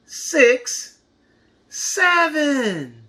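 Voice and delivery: high, bright counting finish; high excited. Shown as a demonstration. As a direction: sing out the last numbers. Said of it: "counting aloud for kids".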